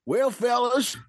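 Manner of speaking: put-on old prospector. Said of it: "old Prospector wanted to bring something up".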